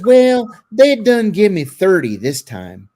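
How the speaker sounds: put-on Appalachian accent, drawn out; North Carolina mountain accent. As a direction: imitate a North Carolina hillbilly. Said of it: "he was from the mountains of North Carolina".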